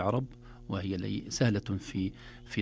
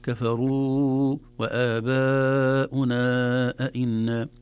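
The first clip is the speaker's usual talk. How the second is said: وآباؤنا with long stretched vowels; slow deliberate recitation demo; drawn-out madd; 3 semitones higher, 6 dB louder, much slower than usual. Shown as a demonstration. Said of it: "هذه تحتاج إلى عناية".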